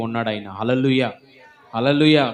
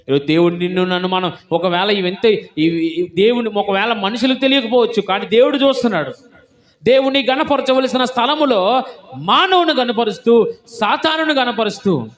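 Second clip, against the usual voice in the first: higher and louder, building fervent sermon; preaching at full force; 8 semitones higher, 7 dB louder than usual. Acted. Preacher warns the congregation, building to a fervent shout. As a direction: thunder it out to the church.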